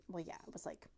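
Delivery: breathy half-whisper, barely voiced; whispered aside. Real, not acted. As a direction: drop to a whisper to admit it. Concedes under breath.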